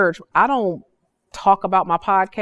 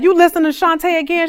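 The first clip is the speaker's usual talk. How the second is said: much higher, louder and quicker mock husband grumble; annoyed husband; 10 semitones higher, 5 dB louder, faster than usual. The woman mimics her husband complaining about the podcast, high mock grumble. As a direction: tease it like a fed-up husband.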